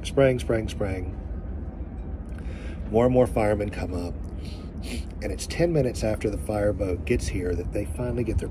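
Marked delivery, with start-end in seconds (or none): none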